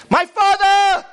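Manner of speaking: very high, loud, stretched cry; long high-pitched exclamation. Acted. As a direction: wail it out like a desperate son. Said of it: "It's like he cries out".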